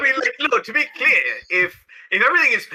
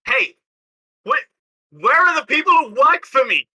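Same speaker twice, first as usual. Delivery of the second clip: indignant shout; 5 semitones higher than usual. Acted. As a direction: yell for your missing staff. Innkeeper angry at missing staff.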